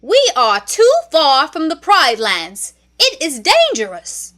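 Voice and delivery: loud, high, fussy bird voice; anxious warning. A narrator's reading. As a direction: squawk the warning like a flustered minister. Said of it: "he warned".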